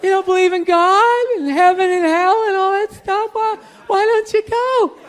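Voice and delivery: mock-worried girlfriend begging him to go to church; girlfriend imitation. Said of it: "She's like".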